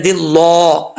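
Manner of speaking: दिल्ला darkened towards dil-law; mistaken heavy lam. Retold or imitated. Teacher mimics some people's mistake, heavy mispronunciation. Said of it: "ऐसा नहीं करना".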